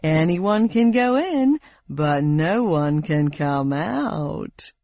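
slow, sinister quiz host; smarmy host with a threat; game show host voice. Acted. Host sets the sinister rule.

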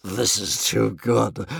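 slow, savouring dragon voice; blissful, drawn-out creature murmur; breathed. A narrator's reading. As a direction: breathe it slowly in delight. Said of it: "breathed One Eye".

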